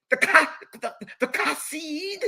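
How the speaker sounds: gag on the K of Kasid; mock guttural Kasid, laughing a little; mock throaty K. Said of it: "you choke on your kit".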